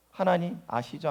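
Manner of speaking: soft, relaxed and low, unbothered; trusting calm. Retold or imitated. Preacher voicing a believer who trusts God and goes to sleep, softly calm. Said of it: "하면서 누워 자는 거예요".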